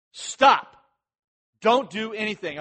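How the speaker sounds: loud, high, abrupt order; forceful one-word imperative; yelled command. Acted. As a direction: shout to halt the crowd. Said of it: "he's yelling at them".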